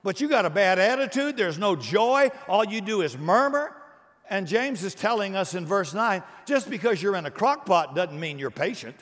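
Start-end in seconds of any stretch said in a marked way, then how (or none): 0.0-3.8 God's voice, higher and louder, scolding. Acted. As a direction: call him out like a disappointed God. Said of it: "God is saying".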